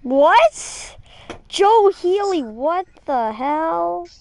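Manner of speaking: loud, high "what" into a drawn-out question; baffled outburst. Real, not acted. Streamer reacting to a nonsense message from a viewer, baffled. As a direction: exclaim in disbelief, then stretch the words.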